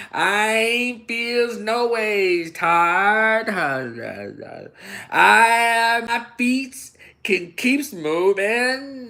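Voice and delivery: high, very slow put-on Southern gospel drawl; exaggerated mock preacher voice; mock Hillary drawl. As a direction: ham up a church-singer cadence. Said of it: "Hillary Clinton did it too".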